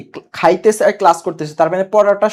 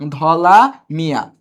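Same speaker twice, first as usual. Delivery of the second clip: ধলা Mia drawn out slowly, word by word; ধলা Mia at half speed; slow repeat; 3 semitones lower, much slower than usual. Shown as a demonstration. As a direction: stretch out ধলা and Mia for the class.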